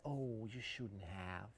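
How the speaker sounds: "Oh, you shouldn't have" drawn out slowly, rising on "shouldn't"; extra polite, slow. Shown as a demonstration. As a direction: stretch it out as if touched by a gift. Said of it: "If you want to be even more polite, say".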